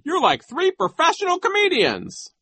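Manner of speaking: very high-pitched, loud character voice; Nero's cry. A narrator's reading. Nero compares the children to comedians, high cry. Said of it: "Nero cried".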